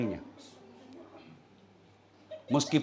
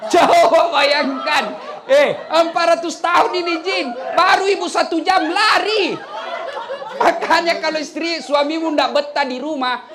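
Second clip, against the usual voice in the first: high, loud shouted punchline; preaching at full force; 13 semitones higher, 9 dB louder than usual. Acted. Preacher shouts the punchline to the mothers, loud and high. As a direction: hammer the joke home to the crowd.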